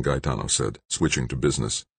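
usual speaking voice

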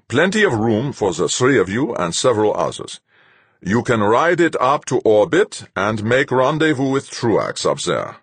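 commanding captain, cold; stern captain. A narrator's reading. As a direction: lay it out like a hard captain. Captain Fuchs' voice.

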